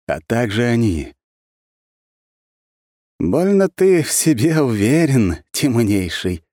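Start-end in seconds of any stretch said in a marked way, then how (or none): 3.2-6.4 high, loud, slow smirk; smirking taunt. A narrator's reading. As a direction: taunt him, grinning. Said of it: "ухмылялся немолодой мужчина".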